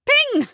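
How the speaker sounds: very high, loud ping; magic ping. Acted. Comedian's fairy making magic, very high ping.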